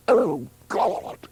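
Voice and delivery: high-pitched cry, only moderately loud; shouted in pain. A narrator's reading. Woman in labour to her helpers, shouting. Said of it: "Juno shouted".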